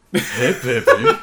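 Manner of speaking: slow raspy mugger voice; low threatening jittery hiss. Retold or imitated. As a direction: leer at them like a street mugger. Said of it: "I listen to it sometimes".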